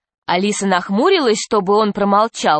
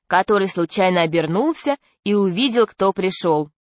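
ordinary delivery

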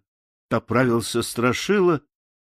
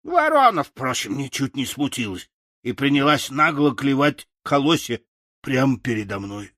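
plain reading voice

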